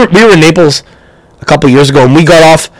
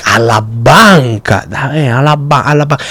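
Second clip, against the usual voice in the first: slow knowing repeat; 3 semitones lower, 4 dB quieter, slower than usual. Retold or imitated. Driver slowly repeating bank.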